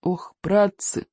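slow, drawn-out moan of dismay; groaning. A narrator's reading. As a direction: groan it wearily, stretching the words. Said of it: "простонал Икинг". (протяжный стон)

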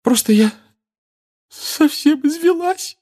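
high, slow tearful voice. A narrator's reading. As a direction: speak worn out by tears. Molly crying.

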